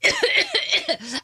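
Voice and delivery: a staged cough that cracks into a giggle; fake cough breaking into a laugh. Prank caller fakes a cough and nearly laughs, cracking cough. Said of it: "I don't sound very well".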